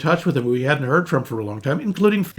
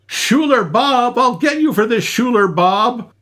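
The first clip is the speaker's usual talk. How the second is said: higher-pitched imitated movie yell, drawn out on the name; mock yell; 7 semitones higher, 3 dB louder than usual. Host quoting an angry movie character's threat, mock yell. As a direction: call it out like a threat, without full volume. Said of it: "Bartleby yells".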